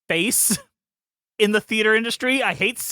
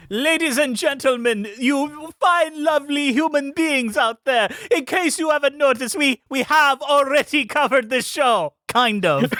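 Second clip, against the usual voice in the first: put-on lightly done Eastern European accent; 7 semitones higher than usual. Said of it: "an Eastern European accent this entire time".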